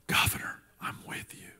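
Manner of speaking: soft whisper of private support. Retold or imitated. Supporters quietly telling the governor they back him, whispered. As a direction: breathe it like a secret.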